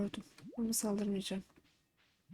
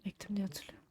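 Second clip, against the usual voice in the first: soft unvoiced whisper; 8 dB quieter than usual. Real, not acted. Player unsure about the fertilizer, whispered aside. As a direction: murmur it to yourself.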